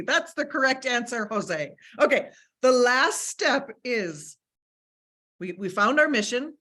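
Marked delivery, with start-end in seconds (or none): none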